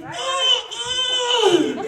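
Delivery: high yelping oh, oh; mock shocked cry. Comedian seeing her weight loss, high mock yelp. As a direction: gasp at the scale in mock alarm. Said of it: "I was like".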